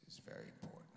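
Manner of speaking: unvoiced mock-secret whisper. Guard whispering his big secret. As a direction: breathe it like a secret.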